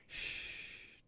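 long, soft shh; hushing. A narrator's reading. Cat hiding under a table from pursuers, hushing. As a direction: hush someone secretly.

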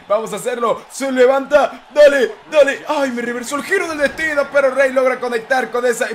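ordinary talk

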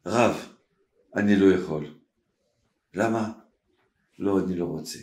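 low, soft retold exchange; subdued refusal. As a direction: voice it low and quiet, holding back. Minister refuses the rabbi's request. (סירוב שקט ונמוך)

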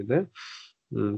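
ordinary talk

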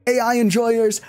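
high-pitched mock-wailing jeer; silly high taunt at a crowd; odd put-on voice. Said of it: "what that was even supposed to be".

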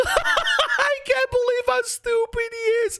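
high, slow, laughing through the words. Real, not acted. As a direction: laugh while you say it. Laughing at friend.

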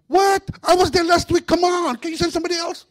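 very high, loud protesting demon; whining demon. Acted. Preacher voicing a demon begging off the job, high and loud. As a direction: complain like a put-upon little devil. Demon complaining about assignment.

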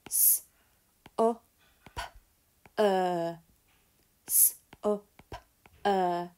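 teacher sounding out supper; Fred talk. Shown as a demonstration. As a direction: sound out each part separately. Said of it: "and Fred talk".

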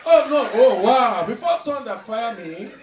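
loud, high cries of disbelief; mock shock. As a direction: exclaim in hammed-up alarm. Mock alarm at audience's drink.

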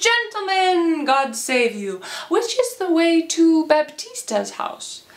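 high, stretched-out lordly greeting; grand gentleman. Acted. Servant posing as Lucentio asks the way, grand gentleman voice. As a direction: hail them with showy courtesy.

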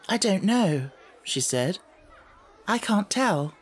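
put-on light girl voice. A narrator's reading. Sarah answers modestly.

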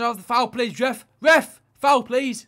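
loud, high appeal to the ref; calling for a foul. Real, not acted. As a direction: yell at the referee for the foul.

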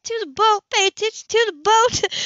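high, loud urgent order; alarmed scolding. A narrator's reading. Woman on the boat, loudly scolding him to steer.

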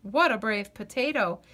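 plain reading voice

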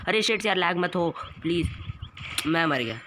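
begging the lag to stop; panicked gamer. Real, not acted. Lag while dying, panicking.

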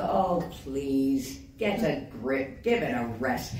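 low, dismissive scoffing voice; mocking the scoffers. Mocking the Doctor Who doubters in a low scoff. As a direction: sneer it like a bored sceptic. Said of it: "that usual thing where we all go".

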